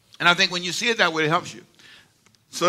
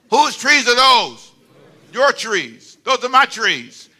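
loud, drawn-out, higher preaching; emphatic declaration; 6 semitones higher, 6 dB louder, slower than usual. Acted. Pastor owning his trees, emphatic declaration. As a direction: hammer it home slowly and loudly. Drives home ownership of the trees.

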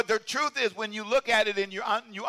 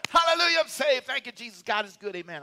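mock rejoicing; 5 semitones higher than usual. Preacher imitating a shallow new believer, high mock joy. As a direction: shout it like an easy convert.

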